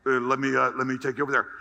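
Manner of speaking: lightly voiced guard, low and courteous; a hint of an obliging guard; polite security guard. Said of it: "Extremely polite".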